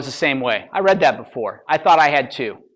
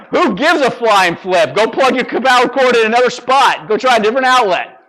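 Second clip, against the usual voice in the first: louder, higher, scornful; loud mock exasperation; 7 semitones higher, 6 dB louder than usual. Speaker scolding the crowd about excuses, loud and scornful. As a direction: brush off the whining loudly.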